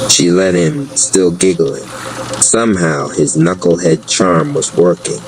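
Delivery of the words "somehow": plain reading voice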